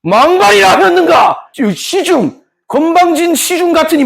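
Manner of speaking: high, drawn-out period-drama outrage; hammed-up offended grandee; haughty Sangdaedeung. Sangdaedeung rebukes the chief secretary, mock haughty fury. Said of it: "그러면 상대등이".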